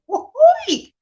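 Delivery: very high, loud cheer; real cheeky cheer; playful whoop. Real, not acted. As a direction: whoop it out like a celebration.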